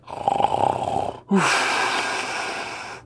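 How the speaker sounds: long breathy snore sound; soft drawn-out snore imitation; imitated snore. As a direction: mimic the roommate snoring away. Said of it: "And he's like".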